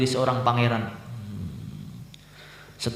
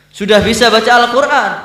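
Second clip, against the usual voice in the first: high, loud, urgent question to the son; eager father; 11 semitones higher, 11 dB louder than usual. Acted. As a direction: call out to the son, bursting to know. Father questions his long-lost son.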